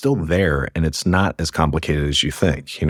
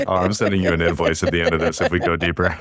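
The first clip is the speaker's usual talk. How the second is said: laughing. Real, not acted. Joking after free therapy quip, laughing.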